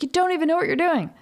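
shrill mock outrage from the cameraman on the phone; angry cameraman. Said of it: "he was really mad".

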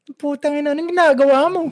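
mock Bulacan accent as the cameraman shouts. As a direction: yell "anong ginagawa mo" in a thick Bulacan accent. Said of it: "Ganun yung accent".

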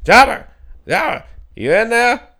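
calling into yards, hammed-up; imitating the neighbour yelling for her dog; mock yell. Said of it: "you can hear her just yelling".

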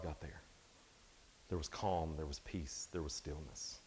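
preacher hushing the room about God's calm; fading to hushed. Acted. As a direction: let each phrase die away into stillness. Describing God's stillness, fading.

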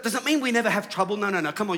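ordinary talk

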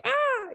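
sudden high panicked shriek; trapped animal shriek. Retold or imitated. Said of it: "you're an animal in a cage and you're just like".

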